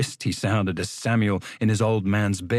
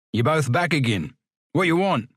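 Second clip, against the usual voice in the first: a hint of a surly doorman; gruff porter; 5 semitones higher than usual. Porter Ferriby confronting the returning boys, gruff. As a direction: challenge them, rising high.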